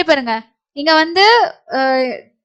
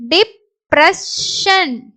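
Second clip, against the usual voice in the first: slow, separated syllables; 3 semitones higher, much slower than usual. Shown as a demonstration. Teacher sounds out the word for learners, syllable by syllable. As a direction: break "depression" into three pieces with pauses.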